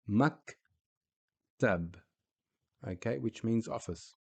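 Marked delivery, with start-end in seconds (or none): 0.1-2.0 "Maktab" with the k and final b cut to half a sound; Arabic word demo. Shown as a demonstration. Teacher shows sukun on the k, slow and careful. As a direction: stop the k in "Maktab" dead, no vowel. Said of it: "half the sound of the actual letter itself".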